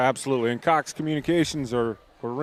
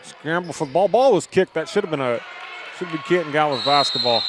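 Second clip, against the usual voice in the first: urgent call with a raised burst midway; live play-by-play; 2 semitones higher, 4 dB louder than usual. Real, not acted. Announcer calling a kicked ball, excited play-by-play. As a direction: follow the scramble with quick surges of energy.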